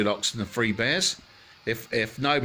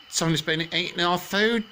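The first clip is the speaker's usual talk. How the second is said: Mummy Bear; 8 semitones higher than usual. Acted. Mummy Bear voiced in story.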